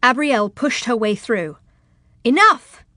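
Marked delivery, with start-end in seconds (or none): 2.2-2.8 high, loud command; sudden commanding shout; forceful cry. A narrator's reading. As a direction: cut through the crowd with one word. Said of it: "she cried with force".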